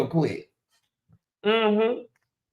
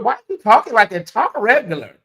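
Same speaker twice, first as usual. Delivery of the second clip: ordinary delivery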